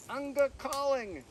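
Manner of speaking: a fledgling trying a new word; baby bird cry. Acted. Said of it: "one of the babies cried".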